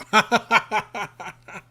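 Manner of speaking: big high cackle of a laugh; a real, loud laugh breaking out; loud burst of laughter. Real, not acted. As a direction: crack up hard at the joke. Cracks up at Orville joke.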